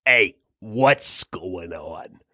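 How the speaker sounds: low, very slow eerie echo; the beard mimics you, slow and deep. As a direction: drag it out low like a creepy copy. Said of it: "And it goes".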